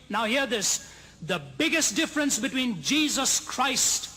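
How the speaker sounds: full-voiced climactic preaching to the congregation; preaching at full force. Closing climax of the sermon.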